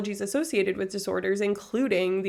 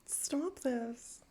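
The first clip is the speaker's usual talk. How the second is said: very soft, overwhelmed murmur; hushed plea; 3 semitones higher, 13 dB quieter than usual. Real, not acted. Streamer overwhelmed by gift subs, hushed plea. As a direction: say it small and hushed.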